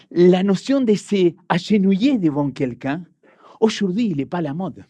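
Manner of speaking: ordinary talk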